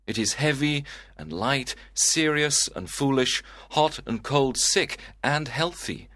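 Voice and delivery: lovesick sighing. Acted. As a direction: lament unrequited love to your cousin. Lovesick Romeo laments.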